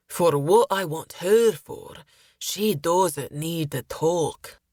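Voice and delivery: sailor's leer, slowing right down at the end; lewd sailor character. Acted. Sailor paying coin for Caitlin, lewd character voice. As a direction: draw out the crude insinuation in character.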